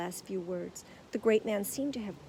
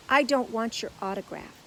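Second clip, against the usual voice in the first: firm; 3 semitones higher, 7 dB louder than usual. A narrator's reading. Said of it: "fixing him with a hard stare".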